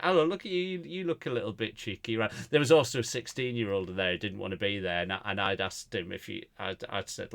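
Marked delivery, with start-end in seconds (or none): 0.0-2.2 a hint of cooing at a toddler, higher; lightly sweet talk to a small child; gently mock-cutesy greeting to a child; child-friendly host voice. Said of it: "So I just went".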